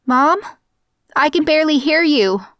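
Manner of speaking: high, loud, alarmed calling; yelled into phone. A narrator's reading. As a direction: yell to be heard over a bad line. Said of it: "I yelled into the phone".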